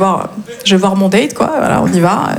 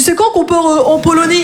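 higher clueless pupil voice; élève naïf; 5 semitones higher than usual. Acted. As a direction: ask it in all innocence, pitched up. Imite Kevin qui questionne la prof. (voix aiguë d'élève naïf)

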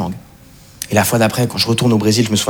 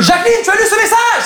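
high-pitched frantic mock shout; hammed-up outraged screech; all-caps shout. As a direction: yell a text written in capitals. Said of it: "en majuscule".

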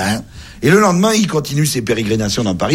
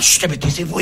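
chut agacé, voix plus haute; spectateur agacé; 6 semitones higher than usual. Acted. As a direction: fais taire un voisin bruyant. Spectateur fait taire le bavard. (chut agacé de spectateur)

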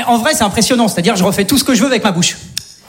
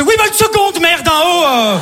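mock snapping back; 6 semitones higher, slower than usual. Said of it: "je me retourne, je fais".

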